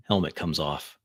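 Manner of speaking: ordinary delivery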